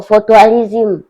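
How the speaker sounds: very slow, stretched-out sarcastic punchline; sarcastic slow tease about the referee's holiday; mocking drawl. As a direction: drag the words out to mock him. Mocking ref came for tourism.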